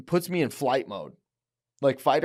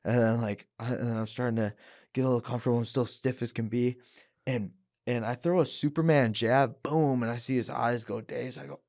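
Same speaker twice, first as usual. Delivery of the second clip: ordinary delivery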